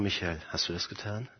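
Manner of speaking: low, very soft question; quiet questioning. Acted. God asks the accused believer about his deed, soft and grave. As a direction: ask it gently, far below normal volume.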